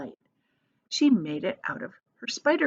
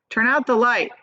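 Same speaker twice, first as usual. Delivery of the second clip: louder, firm order; raised voice; 4 semitones higher, 5 dB louder than usual. Acted. Centipede raising his voice at the room, firm and louder. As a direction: raise your voice to be obeyed. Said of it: "raising his voice".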